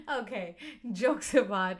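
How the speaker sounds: laughing. Real, not acted. Host laughing off their own joke to viewers, amused. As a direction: chuckle your way back to business.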